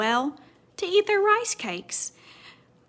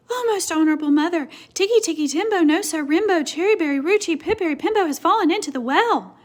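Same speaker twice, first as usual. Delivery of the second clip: put-on urgent child; 7 semitones higher, 6 dB louder, faster than usual. Chang breathless reports to mother.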